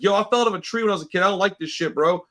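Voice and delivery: fast, rattled retelling of a scare on a ladder; quick half-joking panic; retold panic. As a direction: rush the words out like the ladder is shaking under you. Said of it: "and I was like".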